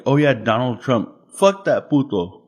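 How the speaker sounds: lightly imitated comedian, slowing on the insult; mock a comic's lazy political bit, pitch lifting at the end; hint of a comedian impression; George Lopez. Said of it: "when it comes to, like, George Lopez".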